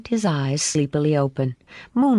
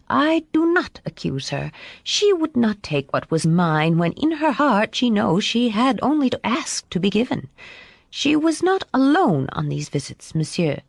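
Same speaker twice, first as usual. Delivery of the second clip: higher-pitched French lady, earnest and insistent; firm, dignified Madame; 6 semitones higher than usual. A narrator's reading. Madame defending the child to her father, firm and earnest. Narrator voices Madame in dialogue.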